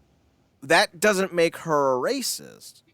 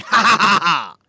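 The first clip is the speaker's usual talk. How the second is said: loud gloating fake laughter; mock gleeful laugh; 4 semitones higher, 10 dB louder than usual. Scene: Iraq throwing grenades into the mix, loud mock cackle. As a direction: cackle like a troublemaker tossing grenades. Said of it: "grenades into the mix going".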